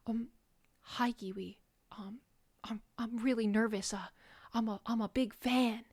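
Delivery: hushed, hesitant mock shyness; fake nervous fan. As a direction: play a timid fan, very soft and halting. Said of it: "he's pretending to be nervous".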